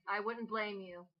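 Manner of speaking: normal talking voice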